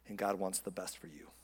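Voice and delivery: low, soft drop in voice; hushed assurance. Real, not acted. Pastor restating God's hope for the congregation, hushed. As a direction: let it sink low and quiet. Preacher restates the softer point.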